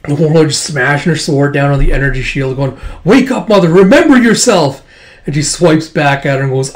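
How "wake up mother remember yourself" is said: high, loud battle cry; desperate shouted plea. Acted. Warlord to Mother at the energy shield, desperate shout. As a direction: shout to break through to someone.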